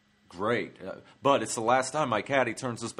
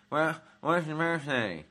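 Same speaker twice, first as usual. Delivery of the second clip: put-on hillbilly accent; 5 semitones higher than usual. Cashier asking a stranger's birthday, Southern hillbilly accent. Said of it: "she was speaking hillbilly-ese".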